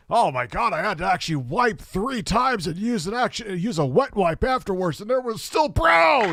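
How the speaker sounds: high whiny mock of Phil. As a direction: squeal it out, high.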